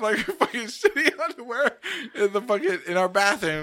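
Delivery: barely holding it together; laughing. Real, not acted. Laughing while quoting friend.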